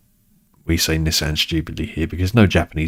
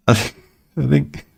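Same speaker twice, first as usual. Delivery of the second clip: ordinary talk, nothing marked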